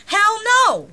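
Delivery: high, loud angry shout; furious, sore loser yelling; angry outburst. Acted. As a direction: bark the refusal at the group. Angry refusal after losing game.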